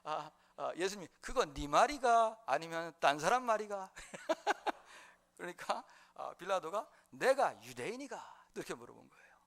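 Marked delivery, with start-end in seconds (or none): 1.2-5.4 slow comic Gyeongsang drawl; Gyeongsang dialect. Retold or imitated. Preacher voices Jesus answering Pilate, in slow playful Gyeongsang dialect. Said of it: "경상도 버전으로 한번 이걸". 7.1-8.4 put-on slow Gyeongsang-accented question; Gyeongsang dialect. Preacher replays Pilate's retort, in slow comic Gyeongsang dialect. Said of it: "경상도 버전으로 한번 이걸".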